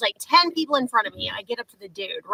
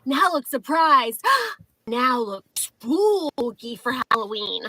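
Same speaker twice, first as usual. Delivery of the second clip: slow, drawn-out taunt with a gasp; mocking commands; 3 semitones higher, slower than usual. Woman ordering ID checker to pull faces, slow mocking. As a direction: stretch the key word theatrically.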